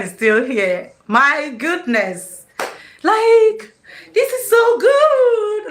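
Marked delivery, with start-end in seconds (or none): 3.0-5.7 high and loud delight; thrilled. Real, not acted. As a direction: gush with joy. Thrilled by live stream.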